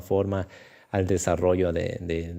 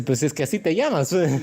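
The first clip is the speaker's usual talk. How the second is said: mother's voice; 7 semitones higher than usual. Retold or imitated. Mother answers in retold story.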